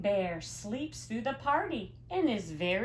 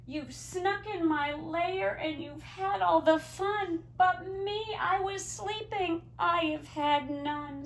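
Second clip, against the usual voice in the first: higher, whiny voice of a sulking bear; sulky bear; 9 semitones higher than usual. Acted. Bear complaining to the animals about missing the fun, in a higher, whiny voice. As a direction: complain like a left-out child. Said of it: "says the bear".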